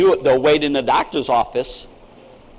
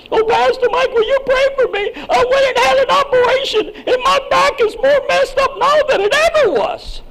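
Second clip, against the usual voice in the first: very high, louder, whining complaint; mock-whiny plea about a botched operation; whiny congregant; 3 dB louder than usual. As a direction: squeal it like a fussy patient. Mocking a complaining post-surgery congregant.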